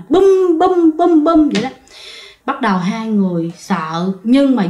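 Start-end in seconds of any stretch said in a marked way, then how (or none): 0.1-1.7 high booming "bum" for each thump; imitated thumping. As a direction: mimic repeated bangs on a cushion, pitched up. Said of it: "giống như ai mà đánh vô trong niệm vậy nè".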